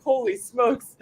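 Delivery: laughing through the words; laughing exclamation. Retold or imitated. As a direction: blurt it out mid-laugh. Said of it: "And I was like".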